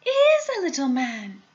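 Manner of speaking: put-on high, amazed child's voice; crying out. A narrator's reading. Child showing grandpa the little man, crying out. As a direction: insist excitedly, pitch jumping up. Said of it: "cried Charlie".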